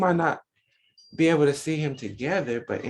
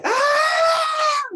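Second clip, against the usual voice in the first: long high loud scream; newborn's loud scream, held long and high; joyful scream; 6 dB louder than usual. Retold or imitated. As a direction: let out a free open yell. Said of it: "they come and say".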